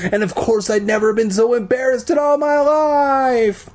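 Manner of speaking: climbing high, long-held last word; mortified wail. A narrator's reading. Mimics cracking, mortified voice.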